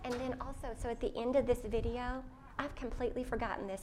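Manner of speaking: ordinary talk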